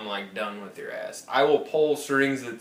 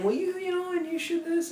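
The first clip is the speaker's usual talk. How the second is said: a much higher, syrupy imitation of the retention agent; high mock sweet agent. The caller mimics the cable rep pitching deals, high mock-sweet voice. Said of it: "she would come up with this nice thing".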